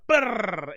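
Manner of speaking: loud, high trilled "brrr"; rolled brrr sound effect. Retold or imitated. Imitating the algorithm racking up engagement, rolled brrr. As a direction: roll out a brrr that slides down in pitch. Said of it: "It's gonna be like".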